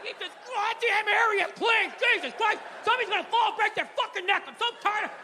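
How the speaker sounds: hammed-up house-rage shout; mock angry rant. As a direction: bark it like a fed-up husband tripping over clutter. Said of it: "You can be mad like".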